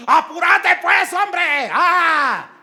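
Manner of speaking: high, impatient yell; shouting. Acted. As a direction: act out someone yelling at a dawdler. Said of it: "una persona gritando". (grito impaciente, actuado)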